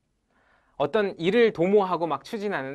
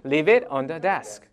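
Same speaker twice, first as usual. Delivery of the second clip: very slow, each word spaced; slow model; 3 semitones lower, much slower than usual. Shown as a demonstration. Teacher modelling the phrase slowly for students. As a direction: lay it out slowly for learners. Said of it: "시작".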